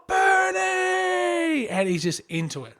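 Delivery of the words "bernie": high desperate shout across the park; frantic yell at a runaway dog; yelling dog's name. Retold or imitated. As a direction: call the dog back in a panic.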